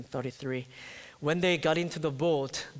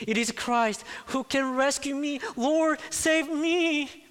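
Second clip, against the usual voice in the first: heartfelt cry; 10 semitones higher, 6 dB louder than usual. Acted. Preacher voicing sinking Peter's sudden realization, heartfelt cry. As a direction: cry out for rescue, climbing higher. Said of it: "Peter's heartfelt cry".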